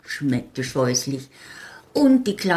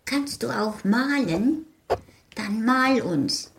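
little folk asking; 6 semitones higher than usual. Acted. Little people ask her to paint.

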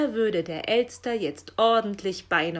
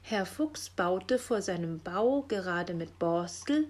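usual speaking voice

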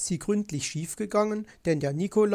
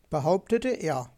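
ordinary delivery, nothing marked

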